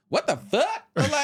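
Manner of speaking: high-pitched comic outrage; retold outrage. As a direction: blurt it out in mock disbelief. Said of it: "I was pissed".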